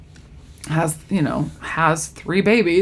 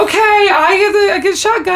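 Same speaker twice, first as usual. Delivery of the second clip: excited calling out; 12 semitones higher than usual. Retold or imitated. Woman in labor claims her seat, excited. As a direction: call shotgun in a rush.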